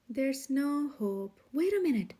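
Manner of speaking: usual speaking voice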